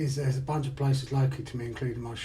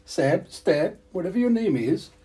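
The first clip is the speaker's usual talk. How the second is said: mock northern English accent, higher and louder; 6 semitones higher, 6 dB louder than usual. Talking to Steb in a mock northern English accent. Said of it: "My northern accent".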